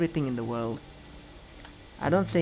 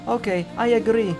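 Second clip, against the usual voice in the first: high, loud giving-in; cornered, pitched-up surrender; exasperated agreement; 8 semitones higher, 6 dB louder than usual. A narrator's reading. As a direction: give in with raised voice. Giving in to the bargain.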